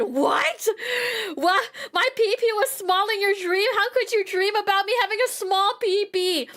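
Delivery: high, indignant disbelief; mock outrage. Retelling her reaction to his dream with high indignation. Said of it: "And I said".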